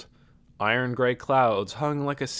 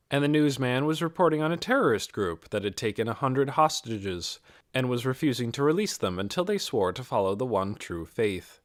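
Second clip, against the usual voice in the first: ordinary delivery, nothing marked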